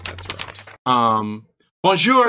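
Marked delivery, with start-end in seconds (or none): 1.8-2.3 put-on high bonjour in French accent. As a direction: greet them in a French accent.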